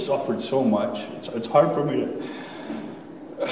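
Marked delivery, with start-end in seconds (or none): 1.2-3.5 voice catching. Real, not acted. Choking up about how he treated his kids.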